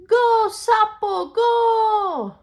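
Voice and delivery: high, loud and slow cheer; cheering shout. Acted. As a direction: cheer the racer on. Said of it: "voices shouted".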